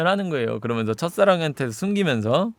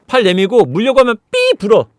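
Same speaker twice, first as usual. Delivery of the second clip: usual speaking voice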